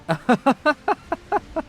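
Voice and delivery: high, rapid giggle; excited giggle. Real, not acted. Delighted at the nuke launch.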